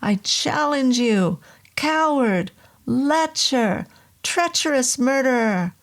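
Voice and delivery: defiant shouted insults; furious taunts. Acted. Hurling insults at the demon.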